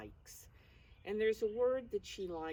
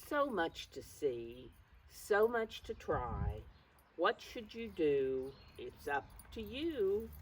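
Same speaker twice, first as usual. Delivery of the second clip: lively, slightly louder storybook reading; reading to children; 2 semitones higher, 4 dB louder than usual. Acted. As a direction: cheer the children on as you read the page. Reads the book text aloud.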